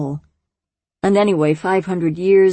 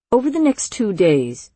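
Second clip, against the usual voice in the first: ordinary delivery, nothing marked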